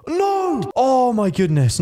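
high, drawn-out disbelief; amazed exclamation. Real, not acted. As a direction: cry out high, then stretch it slowly. Reacts to a kill.

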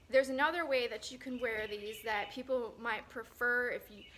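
usual speaking voice

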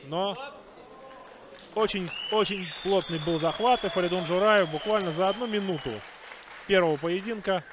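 live play-by-play; wrestling commentator. Acted. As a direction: call the action as it happens.

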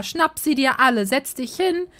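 normal talking voice

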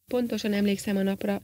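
plain reading voice

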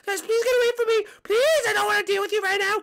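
very high, fast, loud squeal; exasperated pleading. Acted. Peach begging fans to leave, shrieking exasperation. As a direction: shoo them off in a frantic high squeal.

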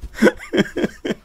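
squealing high-pitched laugh. Real, not acted. Lacht laut. (hohes Lachen)

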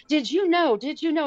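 high, eager questions; a husband bursting with news; husband on the plane, high and eager; excited husband. Retold or imitated. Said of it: "he's like".

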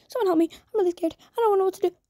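ordinary delivery

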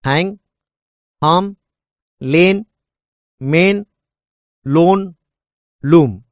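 each word said loose and open, with a pause after it; easy, relaxed throat on every word; relaxed demo words. Shown as a demonstration. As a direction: drill words said one by one. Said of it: "say the following words".

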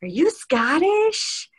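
high, stretched-out imitation of the actor; exaggerated star voice, drawling the question; Kiefer Sutherland. Actor questioning a fan, drawn out and high. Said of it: "he was like".

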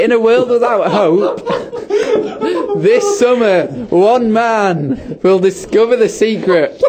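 mock trailer voice through laughter; dramatic promo read, slowing at the end; movie voiceover. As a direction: announce it like a film trailer, amused. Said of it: "the movie voiceover guy".

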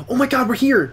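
high-pitched eager cry; excited. Real, not acted. Arriving at the dinosaur place, excited. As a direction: burst out with delight.

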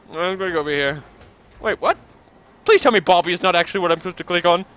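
ordinary delivery

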